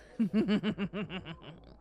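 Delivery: put-on breathy drunk laugh; tipsy laugh. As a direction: laugh at your own joke. Laughing at her own joke.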